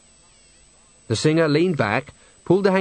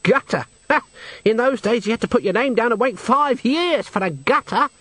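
higher-pitched, indignant scoff; 10 semitones higher than usual. A narrator's reading. Henry corrects Nanny about his poor childhood, scoffing. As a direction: throw the word back, then top it.

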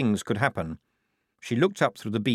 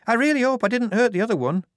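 higher, louder moaning worry; 13 semitones higher, 5 dB louder, faster than usual. A narrator's reading. Shufti above worrying about the guard she hit, high and moaning. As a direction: fret aloud to no one. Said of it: "moaned Shufti".

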